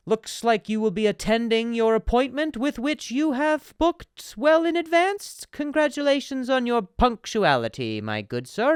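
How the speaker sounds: slow, high, loud mock-formal voice; pompous persona. The client greeting Andruski with exaggerated ceremony. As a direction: ham up the stuffy gentleman. Acted posh Russian persona.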